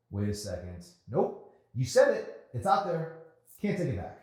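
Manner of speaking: gotcha, you said it; playful victory crow; gleeful teasing. Acted. Emery teasing triumphantly, louder, higher.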